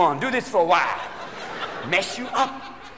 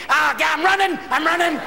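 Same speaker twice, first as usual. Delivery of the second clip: coked golfer in cart; 7 semitones higher than usual. Retold or imitated. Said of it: "cart going".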